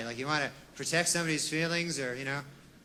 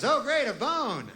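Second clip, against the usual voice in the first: slow, higher, dripping sarcasm; sarcastic dog; 7 semitones higher, slower than usual. Pretend. The dog mocking his rubber bone gift, slow and sarcastic. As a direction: let the dog sound unimpressed and slow.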